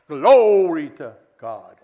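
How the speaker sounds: "Glory" drawn out very slowly and loudly on the first syllable; hammed-up revival "Glory to God", first syllable sustained and swooping; drawn-out charismatic glory. Shown as a demonstration. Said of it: "pronounce the word glory with".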